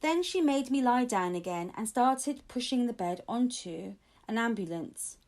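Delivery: ordinary delivery, nothing marked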